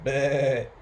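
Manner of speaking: loud, wavering baa; sheep bleating. A narrator's reading. Storyteller voicing the new sheep, bleating. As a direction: imitate a sheep with one bleat. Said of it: "and the sheep going".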